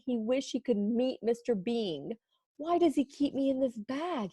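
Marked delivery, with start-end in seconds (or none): 2.6-4.3 soft, higher puzzled wonder; a gentle puzzled little voice; wondering aloud. Acted. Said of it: "he wondered".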